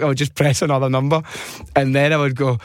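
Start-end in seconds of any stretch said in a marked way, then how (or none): none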